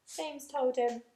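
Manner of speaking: usual speaking voice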